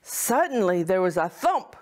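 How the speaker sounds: ordinary delivery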